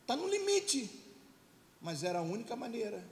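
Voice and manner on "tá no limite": high, loud cry of being at the limit; emphatic high-pitched repeat; strained outcry. Acted. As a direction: push it up high and loud, insistently. Acts out being at pain's limit.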